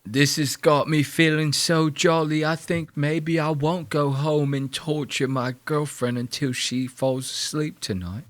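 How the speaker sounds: a hint of a creepy British bloke; mock British accent. Voicing Francis as a gloating British creep, mock accent. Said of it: "He's like".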